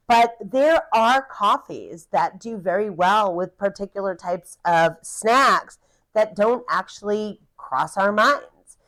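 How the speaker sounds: usual speaking voice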